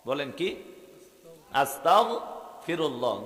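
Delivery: ordinary talk, nothing marked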